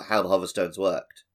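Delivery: ordinary talk, nothing marked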